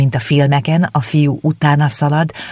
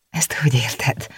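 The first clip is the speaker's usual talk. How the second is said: a wondering, breathless whisper; surprised whisper; 5 dB quieter than usual. A narrator's reading. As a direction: whisper it, taken aback. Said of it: "csodálkozott Éva".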